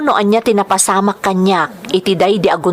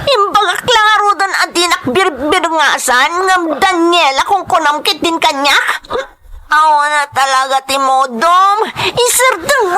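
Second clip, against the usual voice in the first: put-on drunken rant; 5 semitones higher than usual. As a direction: rage at him while grabbing the wheel. Drunken rant while car moves.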